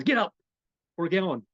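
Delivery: loud, high barked command; mock a pushy roommate yanking you up; bossy wake-up order. Imitating someone barking at him to get up.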